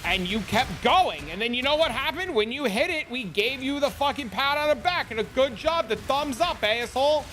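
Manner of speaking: sarcastic cheering rant. Real, not acted. Sarcastic mock celebration rant.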